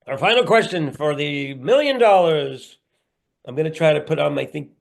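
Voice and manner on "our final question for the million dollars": raised, louder quiz-host announcement; mock game-show host. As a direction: announce it like a big-money quiz climax.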